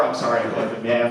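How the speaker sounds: ordinary talk, nothing marked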